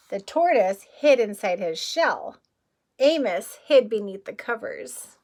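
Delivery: lively picture-book reading; story narration. A narrator's reading. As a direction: read it out to a child at storytime. Reading the book text aloud.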